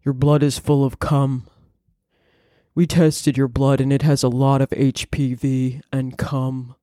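put-on low, level voice; Elizabeth Holmes. Founder telling a patient blood test results, fake deep voice. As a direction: fake deep voice, solemnly pronouncing. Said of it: "Elizabeth Holmes used to sound like that".

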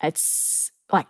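ordinary talk

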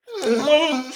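high, far-off howling dog laugh; dog's laugh. Pretend. The hound dog laughs while Bob keeps talking, a high howl. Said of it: "the dog goes".

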